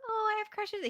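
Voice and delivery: high, swooning mock of a crush-struck girl; mock girly friend. Mom mocking her daughter's friends: high swooning girly voice. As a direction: lay on the giddy schoolgirl crush.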